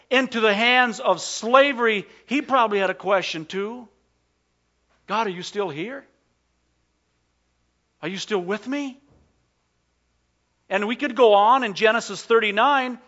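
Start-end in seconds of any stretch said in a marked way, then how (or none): 5.1-9.0 soft, tentative appeal; hushed questioning. Acted. Preacher voicing young Joseph questioning God, hushed. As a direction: ask it quietly, unsure of an answer.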